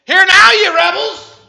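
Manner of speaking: slow, very high, very loud roar; furious Moses. Acted. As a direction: blast it at the rebels. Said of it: "He's about ready to have a five-alarm fire".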